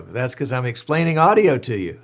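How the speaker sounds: real laughter while explaining; laughing. Real, not acted. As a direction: crack up at the blunder. Laughs at his own audio mistake.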